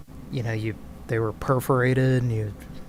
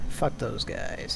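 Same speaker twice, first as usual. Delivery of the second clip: low grumble; 3 semitones lower, 7 dB quieter, slower than usual. Acted. Grumble after sigh.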